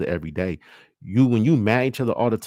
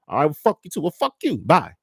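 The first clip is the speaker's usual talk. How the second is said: much higher, sharp "fuck you too" comeback; angry retort; 8 semitones higher than usual. Acted. Mate snapping back in a heated fight. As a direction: throw it back in their face. Acted quarrel, partner snaps back.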